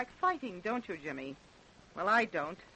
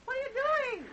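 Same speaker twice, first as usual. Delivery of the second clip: high, carrying call across the water; raised hail to the hunters. Acted. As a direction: shout the question to a distant boat. Calling across the dark lake.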